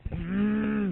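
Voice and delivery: mock the guy's wordless noise; mimicked grunt. Imitating a man on the bus, loud high grunt. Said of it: "making all kinds of sounds".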